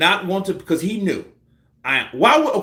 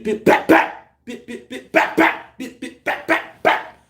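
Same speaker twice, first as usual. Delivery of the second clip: imitated punch flurry; 9 semitones higher than usual. Showing how Loma's punches keep landing, punch sound effects.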